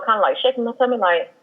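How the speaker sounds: ordinary talk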